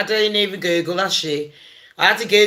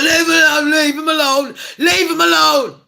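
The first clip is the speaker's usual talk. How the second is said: mock shouted warning, higher; bodyguard shouting; 6 semitones higher, 3 dB louder than usual. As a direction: bark it like a bodyguard pushing someone back. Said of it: "the bodyguard was like".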